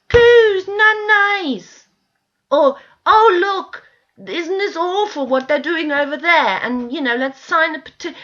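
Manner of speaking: high, loud, hammed-up cooing; mock shallow sympathy. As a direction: parody the people who only coo at pictures. Mocking people's shallow reactions.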